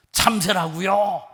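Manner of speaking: loud, high, drawn-out exasperated retort; irritated. Acted. Son who can't be bothered answering father, exasperated shout. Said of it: "한 번 대답하는 것도 귀찮아서".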